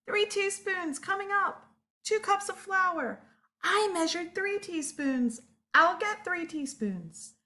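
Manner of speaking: high little monkey chatter; eager little monkey. Acted. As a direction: play a small excited monkey. Monkeys answering the recipe.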